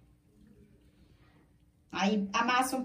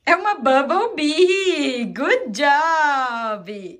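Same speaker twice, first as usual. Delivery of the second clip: praising; 3 semitones higher, 5 dB louder, much slower than usual. Real, not acted. Praising toddler.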